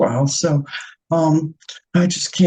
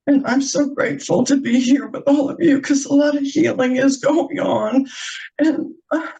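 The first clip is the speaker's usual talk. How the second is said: crying; 9 semitones higher than usual. Real, not acted. Thanking the healing circle, real tearful crying. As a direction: voice high and tearful with emotion. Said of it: "I want to apologize for crying".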